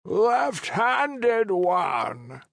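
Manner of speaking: high, slow call from far off; distant call. Acted. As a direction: call him from a distance, drawn out. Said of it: "The voice grew louder".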